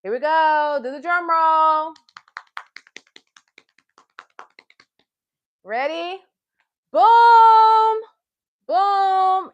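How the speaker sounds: a loud, high booming drum roll call; big loud mock drum boom for the kids. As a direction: shout the boom like a drum hit. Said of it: "we're gonna do our drum roll".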